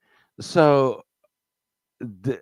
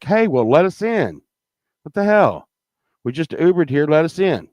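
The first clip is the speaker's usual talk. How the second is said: higher, exasperated let us in; retold annoyed shout; indignant demand; 6 semitones higher than usual. Locked out, demanding entry.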